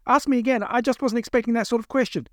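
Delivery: high, urgent appeal; pleading captive. Acted. Captive asking captors to repeat the question, pleading.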